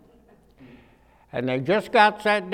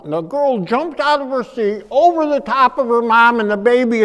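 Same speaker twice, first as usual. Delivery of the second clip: normal talking voice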